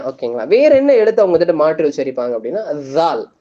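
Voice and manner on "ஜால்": ஜால் with voiced "th" as in this; standard Dhal. Shown as a demonstration. Teacher introducing the next letter, ஜால் with voiced TH. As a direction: press tongue under upper teeth to start ஜால். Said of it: "அழுத்தத்தோட வரக்கூடிய sound".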